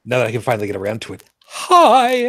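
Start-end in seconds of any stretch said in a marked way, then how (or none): 1.4-2.3 bright, high hello shouted to chat; excited greeting. Real, not acted. Host greets live viewers with a high, loud hi. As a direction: call out hi to the viewers.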